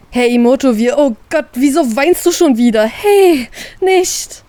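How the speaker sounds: flustered, trying to stop her tears; panicky brother. Acted. Brother panics as his sister starts crying.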